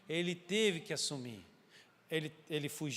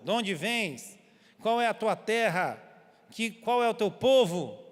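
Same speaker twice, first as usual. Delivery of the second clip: louder, slow demanding questions; stern interrogation; 2 semitones higher, 7 dB louder, slower than usual. Retold or imitated. Sailors interrogating Jonah about the storm, slow and stern. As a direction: press him hard for answers.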